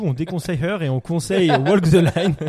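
proclamer bien fort en riant; fausse annonce hilare. Pretend. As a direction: annonce rieuse, nettement plus forte. Revirement pour rire après l'avis adverse. (annonce pour rire, à voix forte)

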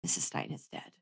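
hushed, breathless whisper of bad news. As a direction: whisper it like a grim secret. Dramatic news in retold scene.

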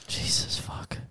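shocked whisper. Real, not acted. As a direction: breathe it out in disbelief. Shocked reaction.

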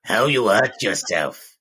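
slow, husky put-on smoker asking; slow smoker's voice. Wife grilling her injured husband in a slow mock smoker's voice. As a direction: drag the words out like a tired smoker. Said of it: "'cause she smokes".

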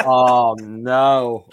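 loud, drawn-out mock "oh no"; retold dismay. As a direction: re-enact a loud groan of dismay.

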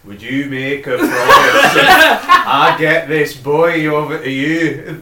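badly faked Scottish accent in one monotone. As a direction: imitate a stilted actor's emotionless Scottish accent. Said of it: "do it in one monotone".

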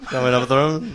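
laughing. Retold or imitated. Friends repeat drunk phrase mockingly.

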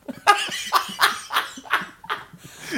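high, airless fit of laughter; breathy high laugh. Real, not acted. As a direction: wheeze with helpless laughter. Laughs at the leg-length idea.

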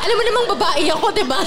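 fast, giggling retold line; laughing quote. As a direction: tease through laughter. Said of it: "Tapos sabi ko sa kanya".

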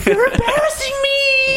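very high, crying mock shout; mock wail. Hamming the groped go-go boy, mock sobbing shout. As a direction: wail it like a wronged damsel.

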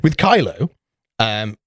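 usual speaking voice

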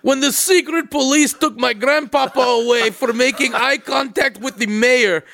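hammed-up Russian accent, slow and drawn out; emotional Russian accent. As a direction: confess tragically in a mock Russian accent. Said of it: "deep, serious conversation, like, an emotional".

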